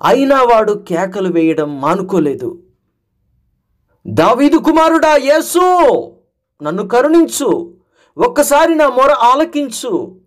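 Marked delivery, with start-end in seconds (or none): 4.1-10.1 higher, desperate cry for mercy; crying louder. Acted. Blind man cries out over the scolding crowd, louder plea. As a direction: call out over the crowd, pleading. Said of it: "అని ఇంకా పెద్దగా అరవడం".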